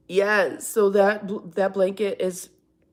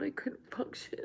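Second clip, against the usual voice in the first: tearful whisper; 6 semitones higher, 10 dB quieter than usual. Real, not acted. Mother tearfully recalling son leaving.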